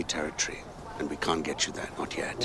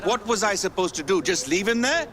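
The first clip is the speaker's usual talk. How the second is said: loud, high, indignant outburst; angry retort; 13 semitones higher, 6 dB louder than usual. Acted. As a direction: fire back in a raised, heated voice. Quarrel over bringing the stranger.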